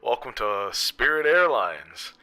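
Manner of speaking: mock pilot's cabin announcement; airline captain. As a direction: pretend captain welcoming passengers aboard.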